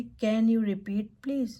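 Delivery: teacher modelling the request on a phone call; slow, loud model phrase. Shown as a demonstration. Teacher modelling a slow, loud request. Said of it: "clarification phrases जैसे".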